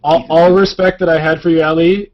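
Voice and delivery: loud, hammed-up indignation; mock outrage. Mock outrage at April Fool's prank.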